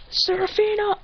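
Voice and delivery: high, strained cry for someone; acted desperate call; urgent calling out. Calling out to her.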